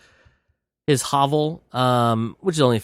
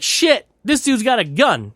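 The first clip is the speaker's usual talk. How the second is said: alarmed yelp; 10 semitones higher, 3 dB louder than usual. Retold or imitated. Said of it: "he's like".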